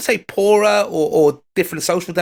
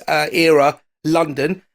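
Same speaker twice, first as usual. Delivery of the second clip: ordinary talk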